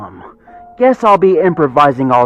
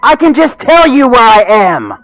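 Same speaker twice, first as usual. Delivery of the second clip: higher, louder irritated snap; 13 semitones higher, 9 dB louder than usual. Acted. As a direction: snap at him in annoyance. Everest keeps scolding.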